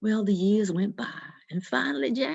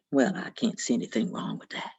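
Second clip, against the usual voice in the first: a much lower, sly devil; 11 semitones lower, 4 dB quieter than usual. Acted. The devil agrees to Jack's last drink in a low voice. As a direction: agreeable but sinister, pitched down.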